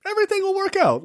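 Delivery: very high, mocking reassurance; mock optimism. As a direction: parody a naive promise in a high voice. Mocking the film's naive message.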